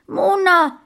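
hoher, lauter Kinderschrei; verzweifelter Schrei. A narrator's reading. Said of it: "schrie Sascha, völlig verzweifelt". (verzweifelt schreiendes Kind)

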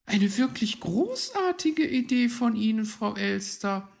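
high, excited little voice; thrilled high-pitched youngster; admiring child. Acted. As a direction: praise her in a high child's voice. Forest children praise the magpie.

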